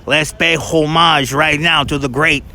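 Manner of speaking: put-on thick Brooklyn accent; old Brooklynese accent. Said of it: "you learn old Brooklynese".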